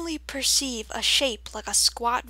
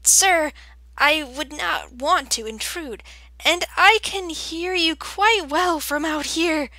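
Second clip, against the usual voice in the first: higher-pitched, polite reluctance; timid; 5 semitones higher, 5 dB louder than usual. A narrator's reading. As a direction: decline nervously in a raised little voice. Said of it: "she said timidly".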